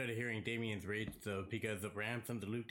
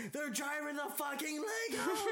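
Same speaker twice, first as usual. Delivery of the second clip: imitated shout; 13 semitones higher than usual. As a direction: shout it, thrilled. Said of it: "He's like".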